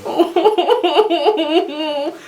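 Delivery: high, put-on little oh through laughter; caught-out squeak, played for laughs; sheepish Chris. Chris caught working with the knight, sheepish and high. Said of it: "Chris is gonna be like".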